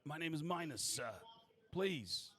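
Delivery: high, deferential character voice; fawning servant; obsequious doorman. Acted. Doorman introduces himself to noble.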